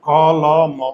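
ক, ল, ম as three separate letters, each with its vowel; name each letter apart: ক, ল, ম. Shown as a demonstration. Teacher counts the three letters in a word, ক, ল, ম said one by one. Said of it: "এখানে তিনটা alphabet আছে".

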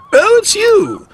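very high, disdainful greeting; haughty old truck. A narrator's reading. Elizabeth looks down at Sir Topham Hatt, haughty. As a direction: look down your nose, pitched high.

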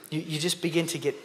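usual speaking voice

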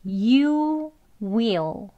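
YOU and WILL drawn out one by one; full dictionary "you", then "will"; separate full words. Shown as a demonstration. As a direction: read "you will" slowly, uncontracted. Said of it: "따로따로 발음 기호대로 읽으면".

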